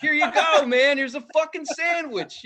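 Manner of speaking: high, loud at first, overeager; eager offering. Pretend. Follower imagines giving the comic a sandwich, high mock-eager offer. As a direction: pretend to hand it over with hammed-up friendliness.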